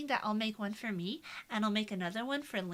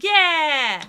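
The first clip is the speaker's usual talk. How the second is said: loud, higher-pitched triumphant yell; excited cheer; 6 semitones higher, 16 dB louder than usual. Real, not acted. Streamer celebrating a clip under a minute, excited cheer. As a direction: burst out cheering.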